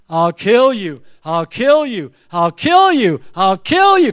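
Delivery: chanted threat, climbing with each repeat; threat ringing in head. Retold or imitated. Dad's threat looping in son's head, rising chant. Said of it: "what have I got going in my head".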